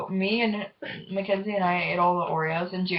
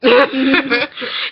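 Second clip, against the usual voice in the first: loud laugh; 6 semitones higher, 7 dB louder than usual. Real, not acted. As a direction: burst of high laughter. Laugh after the prank.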